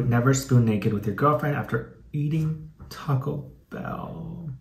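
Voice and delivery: ordinary delivery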